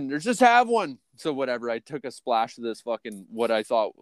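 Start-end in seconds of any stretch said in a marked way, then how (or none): 0.2-1.0 higher-pitched coaxing, imitated; mock peer pressure, pitched up; egging someone on. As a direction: press them to give in. Acting out friends urging a drink.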